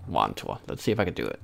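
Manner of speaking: ordinary talk, nothing marked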